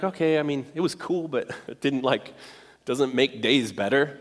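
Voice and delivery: underwhelmed. Acted. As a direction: play a mildly let-down moviegoer, quietly. Acting out letdown after awaited movie.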